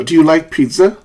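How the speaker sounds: "pizza" lifted upward; rising question. Shown as a demonstration. Teacher models a yes-no question, rising intonation. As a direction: let pitch climb into "pizza". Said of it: "A rising intonation".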